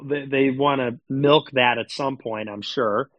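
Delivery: ordinary talk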